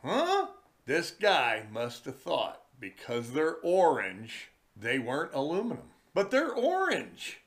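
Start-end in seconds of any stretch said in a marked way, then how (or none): 0.0-0.5 high baffled huh; mock confused grunt. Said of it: "he kinda looked at me like". 6.1-7.4 high, sarcastic they're orange; sarcastic echo of the guy; mocking the guy. Mocking the guy's logic.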